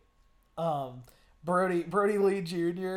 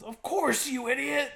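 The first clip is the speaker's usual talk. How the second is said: high, scornful mock retort; imitated wrestler's indignant outburst; mock exasperation; 9 semitones higher, 3 dB louder than usual. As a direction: throw it back as if the answer were obvious. Imitating Jericho snapping at interviewer.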